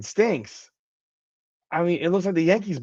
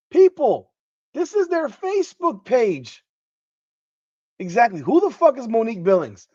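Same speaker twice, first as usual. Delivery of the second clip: incredulous rant; 6 semitones higher than usual. Real, not acted. Streamer venting to chat about the Facebook page, incredulous and high.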